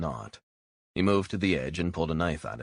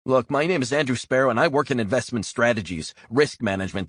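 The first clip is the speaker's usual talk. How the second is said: higher, louder desperate insisting; loud insistent protest; 8 semitones higher, 6 dB louder than usual. Acted. Spy insists on his false name to captor, loud protest. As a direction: push the cover story hard and loud. Prisoner insists on cover story.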